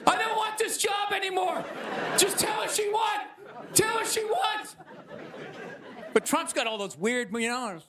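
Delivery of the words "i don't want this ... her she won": high-pitched, hammed-up panicked wail; mock crying. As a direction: beg to quit in a put-on sobbing yell. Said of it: "crying in the fetal position".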